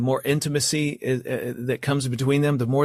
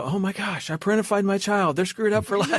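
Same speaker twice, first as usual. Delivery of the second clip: panicked; 7 semitones higher than usual. Retold or imitated. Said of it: "I don't want listeners to go".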